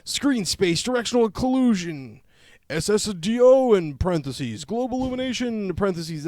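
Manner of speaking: loud, high and building; hyped list read. Pretend. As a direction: shout out the feature list. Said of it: "get ready, this is quite the list".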